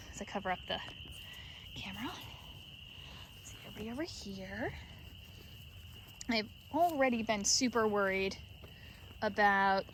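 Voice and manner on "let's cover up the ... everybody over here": very soft whisper. Real, not acted. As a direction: breathe it out quietly. Hiding light from sheep.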